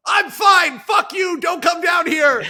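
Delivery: shouted high and loud from far off; drunk shout. Retold or imitated. Drunk Donald yelling up from the ditch. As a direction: bellow it from down the road. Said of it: "you can hear Donald going".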